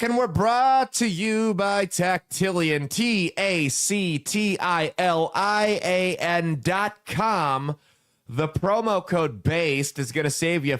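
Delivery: upbeat sponsor read, spelling it out slowly; slow, spelled-out promo voice. Acted. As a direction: announce the sponsor like a radio spot.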